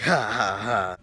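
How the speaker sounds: prankster's breathy "ha, ha, ha"; imitated laugh. Prankster laughing at the scared friend, imitated laugh. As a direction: mimic a guy laughing at his own prank. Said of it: "And then the guy laughs".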